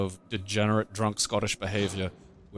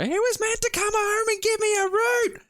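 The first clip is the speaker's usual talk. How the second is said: very high-pitched shriek, hammed-up; mock top scream. Angry girlfriend shrieking at the police, imitated very high scream. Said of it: "voice is still just at fucking top scream".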